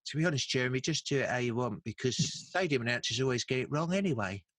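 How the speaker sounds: light impression of a footballer's voice; lightly voiced famous footballer, casual; a hint of a celebrity footballer, offhand; David Beckham. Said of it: "I did a David Beckham voice for the producer".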